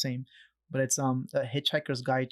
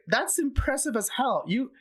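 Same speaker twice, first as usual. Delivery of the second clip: excited cheering; 7 semitones higher, 3 dB louder than usual. Retold or imitated. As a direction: cheer a tired climber on, beaming. Imitating cheering Peruvian hiker.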